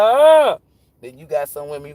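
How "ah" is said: sudden high yelp of shock; startled shriek. Retold or imitated. Women grabbed, yelping in a high shriek. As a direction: shriek like she's been grabbed. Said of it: "they be like".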